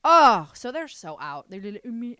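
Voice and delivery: ordinary talk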